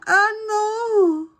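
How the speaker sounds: loud, high cry of dismay. Real, not acted. Crafter crying out at her ruined fern, loud and high. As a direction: shout it out in real dismay.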